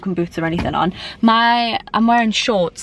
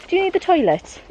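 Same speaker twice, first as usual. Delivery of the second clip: high pet-talk voice; 10 semitones higher than usual. Real, not acted. Asking the dog on the walk.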